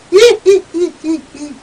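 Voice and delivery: high, loud gloating laugh; triumphant cackle. Acted. Toad laughing in triumph at Julian, high and loud. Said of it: "gloating Toad was triumphant".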